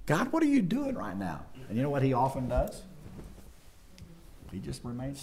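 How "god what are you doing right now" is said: high-pitched acted questioning of God; bewildered plea. Believer in the middle of it questioning God, bewildered. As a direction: cry upward in baffled protest.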